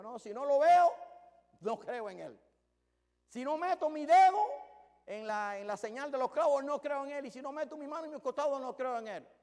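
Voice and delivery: high, loud, insistent refusal, every line pushed hard; defiant shout. Acted. Thomas telling the disciples he won't believe, loud defiant shout. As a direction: hurl the doubt out like a man who won't be convinced.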